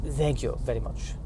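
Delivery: put-on slow, halting English with a French accent. Customer ordering croissant and green tea, slow French accent. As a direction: put on a hesitant French accent, groping for words. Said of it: "English with a French accent".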